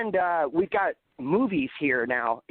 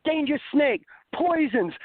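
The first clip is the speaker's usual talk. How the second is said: mock warning; 11 semitones higher than usual. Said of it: "And I'm like".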